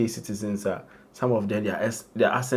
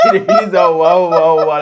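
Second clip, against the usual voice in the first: laughing; 7 semitones higher, 11 dB louder than usual. Real, not acted. Laughing at the interview.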